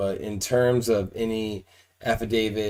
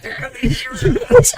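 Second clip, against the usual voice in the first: giggling through the words; can't stop laughing; 11 semitones higher, 10 dB louder than usual. Real, not acted. Interviewer laughing through the question.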